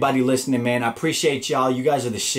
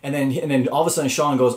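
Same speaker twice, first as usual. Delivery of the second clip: normal talking voice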